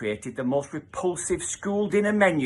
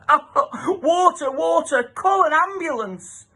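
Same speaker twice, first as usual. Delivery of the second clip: high, loud gasping cries for help; choking panic; 4 dB louder, slower than usual. Acted. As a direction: cry out, choking on the stench. Pupils choking on the stink.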